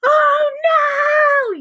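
very high, loud, drawn-out shriek; yelling mother. Acted. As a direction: shriek in horror. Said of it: "yelled the mother".

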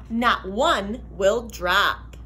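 usual speaking voice

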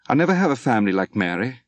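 ordinary delivery

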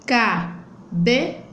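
"Cabeça" slowly split into ca, be, ça; three separate beats on "Cabeça"; syllable by syllable. Shown as a demonstration. Teacher opening the mouth three times, "Cabeça" slowly split. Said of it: "Eu abro a boca três vezes para falar".

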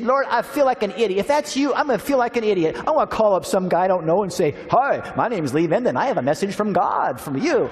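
high, pleading protest to God; exasperated pleading. Retold or imitated. Pastor retelling his argument with God, exasperated and high. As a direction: argue with God, half-laughing at yourself.

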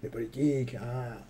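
very slow, low, soft Bedos voice; lightly voiced Guy Bedos, indifferent; Bedos impression. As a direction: drag it out like the old comedian. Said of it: "ça, j'imite Bedos".

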